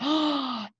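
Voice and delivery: a sudden surprised gasp; sharp gasp. Acted. Mouse bumps into hidden bottle, startled gasp. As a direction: gasp as the head bumps something.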